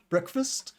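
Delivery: "Breakfast" rising like a question; upward tone. Shown as a demonstration. Teacher isolates the last word for learners, upward tone. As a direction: lift the end of "Breakfast" to ask. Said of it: "kind of has an upward tone".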